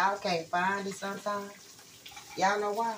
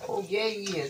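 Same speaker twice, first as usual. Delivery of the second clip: ordinary talk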